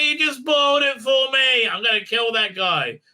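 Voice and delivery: fake-furious at the spoiler; mock outrage. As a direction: play it hammed-up furious. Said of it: "It's like".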